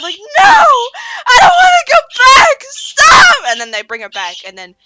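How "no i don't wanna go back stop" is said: very high, loud desperate shriek; screaming for her life. Retold or imitated. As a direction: shriek it like a captive being hauled off. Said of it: "screaming for her life".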